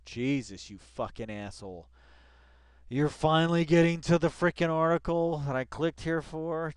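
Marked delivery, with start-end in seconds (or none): 2.9-6.7 louder, higher, fed-up rant; exasperated outburst. Real, not acted. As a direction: vent your impatience. Exasperated at slow video.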